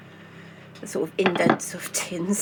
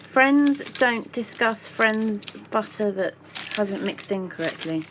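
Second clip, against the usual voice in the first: normal talking voice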